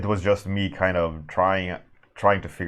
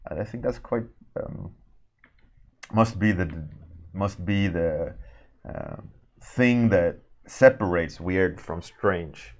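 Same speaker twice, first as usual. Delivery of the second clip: ordinary delivery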